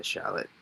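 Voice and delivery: Charlotte as SHA-lit, no R; local dropped-R pronunciation. Shown as a demonstration. Forecaster showing how Massachusetts says it, Charlotte as SHA-lit. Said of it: "we would say it'd be a".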